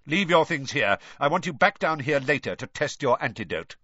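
irritated teacher's command; snapping. A narrator's reading. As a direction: order the pupil about sharply. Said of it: "Snape snapped".

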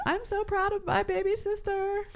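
imitating the sister. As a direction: quote the sister in a squeaky high voice. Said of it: "and then the one says".

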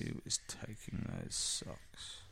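slow, hushed, spooky question; mock mysterious whisper. To her friend, musing on who steals socks, in a mock spooky whisper.

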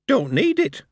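high, bright goblin voice offering loot; cheerful goblin, slowed on the spoils. A narrator's reading. Goblin cheerfully handing Moist the spoils, high goblin voice.

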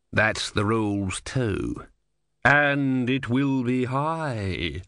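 very slow, looming warning; ominous Sea Queen. A narrator's reading. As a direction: draw out the threat. Sea Queen threatens price.

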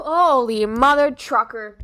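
ordinary talk

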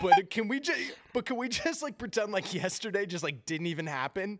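high-pitched, lightly hammed-up awkward plea; imitated sheepish boyfriend. Boyfriend asking girl to forget yesterday, mock sheepish. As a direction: plead a little, as an imitated confused boyfriend.

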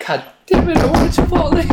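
high, loud cry of frustration; frustrated outburst. Real, not acted. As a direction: yell it like you just lost. Loses the coin toss.

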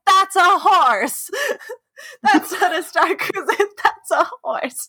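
put-on exasperated little girl, breaking into laughter; hammed-up irritation through giggles; mock annoyed. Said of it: "She's so annoyed".

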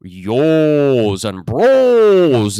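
"yos" and "bros" held long and loud; prolonged vowels. Shown as a demonstration. Mocking Toronto guys, "yos" and "bros" with very long O. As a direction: stretch the O hugely in "yos" and "bros". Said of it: "these very prolonged".

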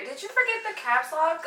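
mock ignorant. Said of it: "I went real ignorant".

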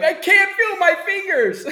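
very high squeaky laughing voice; squeaky mock voice. Mock voice of impaired person.

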